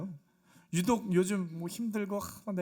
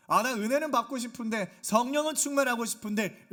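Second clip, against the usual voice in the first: louder, higher imitation of a wishful congregant; mock eager wish; 5 semitones higher, 6 dB louder than usual. Congregant wanting grace without showing up, mock eager wish. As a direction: act out insistent wanting.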